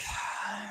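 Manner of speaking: long, quiet exhale; composure-keeping sigh. Retold or imitated. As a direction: breathe out to stay calm. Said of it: "keep this, like, calm composure".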